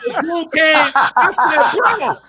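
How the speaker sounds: high, loud, laughing yell; laughing panic. Real, not acted. Man laughing while retelling how he called his friend, loud and high. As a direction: shout it through laughter.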